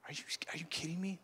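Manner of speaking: whispered, fast grumble; very soft indignant whisper; disgruntled mumbling. Acted. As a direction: mumble it under your breath in disbelief. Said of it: "they're mumbling".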